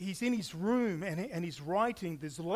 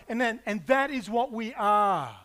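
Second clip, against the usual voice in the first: normal talking voice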